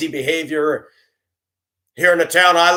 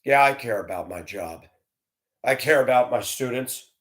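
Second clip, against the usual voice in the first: usual speaking voice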